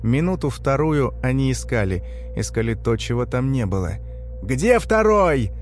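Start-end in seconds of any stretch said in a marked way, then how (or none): 4.4-5.5 high, drawn-out bellow; shout. A narrator's reading. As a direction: yell it impatiently, stretching the words. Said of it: "Крик Лысого".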